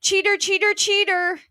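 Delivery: high, accusing shout. A narrator's reading. Wife kicks at the locked door, shouting. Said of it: "kicked at the door and shouted".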